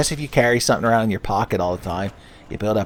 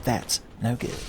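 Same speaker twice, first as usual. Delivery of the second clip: soft Sonic catchphrase; 10 dB quieter than usual. Retold or imitated. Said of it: "Sonic lecturing Tails".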